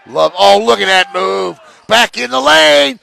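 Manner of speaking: loud, high burst of excitement; real courtside excitement, building to a shout; excited call. Real, not acted. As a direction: shout the play as it happens, pitch climbing. Live call of a sharp move.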